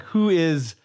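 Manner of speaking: ordinary talk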